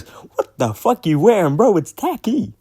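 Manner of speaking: mock rap quote that leaps up high; imitating the rapper. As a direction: mimic a rapper's swooping, high-pitched line. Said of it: "when he goes".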